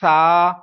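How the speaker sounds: سا said loud with no hiss; teacher shows سا without a whistle. Shown as a demonstration. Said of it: "آپ اس کو کیسے پڑھیں گے؟".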